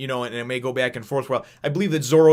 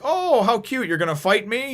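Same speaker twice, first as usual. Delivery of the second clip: high, cooing condescension; mock-sweet taunt; 9 semitones higher than usual. Villain sizing up Nami before their fight, mock-sweet taunt. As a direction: imitate a villain belittling her opponent.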